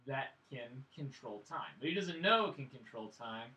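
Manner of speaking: ordinary talk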